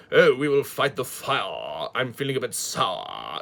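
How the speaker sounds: put-on slow, plummy posh English accent with drawn-out words; posh thespian accent. Reviewer mimicking the thespian's posh English accent. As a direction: play a pompous stage actor in a posh English accent. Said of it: "that sort of accent is what he does".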